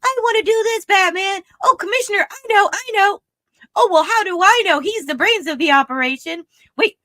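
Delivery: high, bouncy little sidekick chatter; squeaky know-it-all sidekick; Bat-Mite eager kid voice. Retold or imitated. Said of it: "he's just like".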